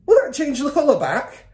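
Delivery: high, loud anxious plea; anxious question. A narrator's reading. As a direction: ask it desperately. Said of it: "the father asked anxiously".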